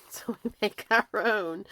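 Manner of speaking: loud, laughing delivery; real laugh bubbling into the line. Real, not acted. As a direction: laugh through the words. Laughs at own therapy joke.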